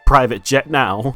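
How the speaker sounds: ordinary talk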